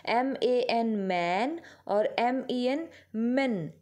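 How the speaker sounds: drawn-out teaching spelling of मैन versus मेन; slow spelled-out demo. Shown as a demonstration. Teacher spelling words slowly for her pupils. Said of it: "तो इसके pronunciation में ध्यान रहेंगे बेटा".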